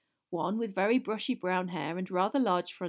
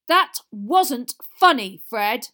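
slow, loud, higher-pitched scolding; angry shout; 4 semitones higher, 13 dB louder, much slower than usual. A narrator's reading. Father tells off his son for a prank, angry shout. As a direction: shout it out word by word, furious. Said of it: "he shouted".

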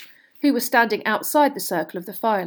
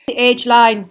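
loud, raised, cutting interjection; sharp; 4 semitones higher, 11 dB louder, slower than usual. A narrator's reading. Professor correcting the others, sharply. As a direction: cut in sharply. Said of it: "Professor McGonagall sharply".

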